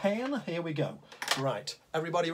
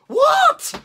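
a sudden, much higher, louder cry; shocked outburst; 16 dB louder than usual. Acted. Narrator springs the teddy surprise on the children, a shocked shout. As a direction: jolt the listeners awake.

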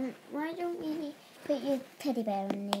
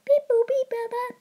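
high beeping phone sound; 9 semitones higher, 8 dB louder than usual. Acted.

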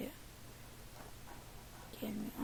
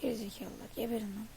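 ordinary talk, nothing marked

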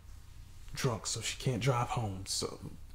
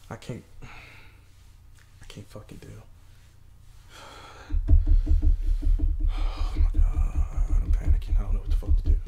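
panicking; 4 semitones lower, 8 dB louder than usual. Acted. Man alone at night panicking over his missing girlfriend. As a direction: fight for breath in panic.

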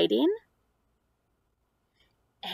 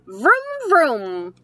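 vroom engine noise; 4 semitones higher, 6 dB louder than usual. Acted. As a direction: make a toy car noise for the child. Boy in book imitates engine.

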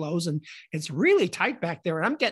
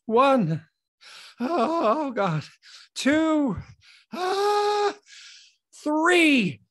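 high, nerve-racked count with gasps between; panicky countdown; 8 semitones higher, 3 dB louder, much slower than usual. Acted. Streaker counting to three before jumping out naked, panicky. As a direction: build up dread on each number.

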